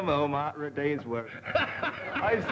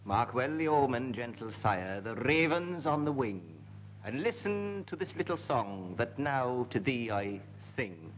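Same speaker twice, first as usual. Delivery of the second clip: lightly mock-Welsh recital, lower and softer; Welsh accent; 4 semitones lower, 4 dB quieter than usual. Panellist continues the Welsh poem in a light Welsh lilt. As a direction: carry on the Welsh bard's verse, hushed. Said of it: "my accent has changed back".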